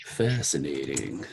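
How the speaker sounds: deep, slow Spock delivery. Pretend. Spock impression again.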